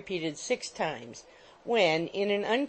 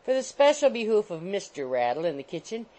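ordinary delivery, nothing marked

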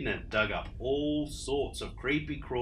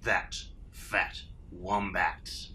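slow, lower menacing dingo; sinister Dingo; 5 semitones lower, much slower than usual. Acted. Dingo reveals the wombat, slow and low. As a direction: gloat it out slowly.

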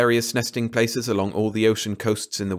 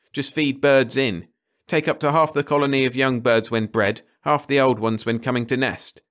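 lightly raised, emphatic planner's voice; decisive ecologist; 4 semitones higher, 3 dB louder than usual. Acted. Carter laying out the bird station job, decisive. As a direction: tick off the plan point by point.

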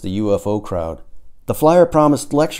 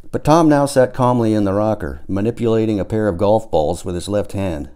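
ordinary delivery, nothing marked

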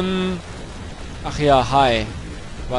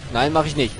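ordinary talk

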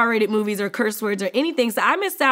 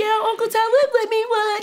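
put-on higher, excited little kid; 10 semitones higher than usual. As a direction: brag like a happy child. Kid bragging about Uncle Talib.

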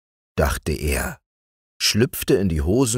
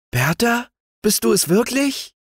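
much higher, joyful recognition; joyful cry. A narrator's reading. Youth recognises his sweetheart and calls out joyfully, much higher. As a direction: cry her name in glad surprise. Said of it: "rief er voll Freude".

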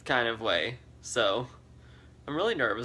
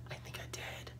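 whispering; 18 dB quieter than usual. Real, not acted. Confessional whisper.

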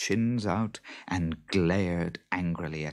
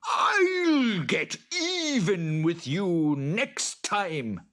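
high, drawn-out creature threat; fuming boggart; 13 semitones higher, much slower than usual. A narrator's reading. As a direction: vow revenge in a slow screech. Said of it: "fuming with anger".

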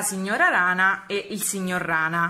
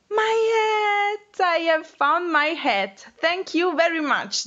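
very high, squealing mouse delight; overjoyed; 9 semitones higher, much slower than usual. Acted. Mouse finding her lost hat and thanking the frogs, overjoyed. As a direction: draw it out slowly, high and thrilled.

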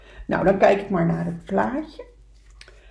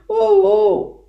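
high, loud "oh-oh" wail; echoed sob; 12 semitones higher, 10 dB louder than usual. Acted. Echo answering the beaver across the lake, high sobbing. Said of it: "snikte de stem aan de overkant".

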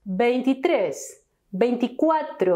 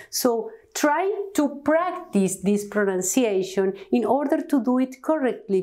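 ordinary talk, nothing marked

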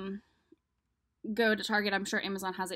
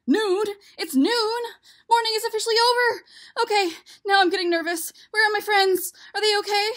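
much higher and louder, rushing nervous cries; panicking egg; 12 semitones higher, 12 dB louder than usual. Acted. Shell realizing it's noon and his friends are missing, frantic and high. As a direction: spiral into frantic worry.

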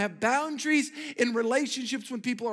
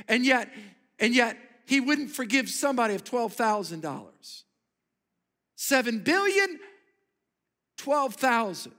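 preaching; 4 semitones higher, 3 dB louder than usual. Acted. Preaching contrast of debts.

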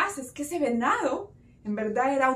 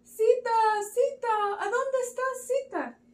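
high, acted calling out; searching call; 11 semitones higher than usual. Brothers search everywhere for Sita, calling worriedly. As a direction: call a missing person's name, pitched high.

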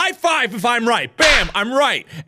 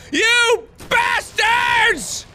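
high, slow, drawn-out furious wail; mad yelling; 10 semitones higher, slower than usual. Real, not acted. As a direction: stretch each insult into a high-pitched rant. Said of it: "I'm so mad".

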